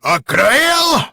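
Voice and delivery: loud, high rolled R; Cleese R roll. Retold or imitated. Host imitates Cleese's rolled R, loud and high. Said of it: "He's got that".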